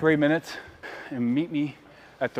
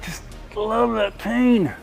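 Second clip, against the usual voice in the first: slow intense urging; 8 semitones higher, much slower than usual. Acted. Motivating, drawn out.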